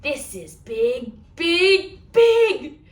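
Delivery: big and growing. Shown as a demonstration. Librarian showing big to children, booming it. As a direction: swell up bigger on every big. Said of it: "make your arms as big as you can".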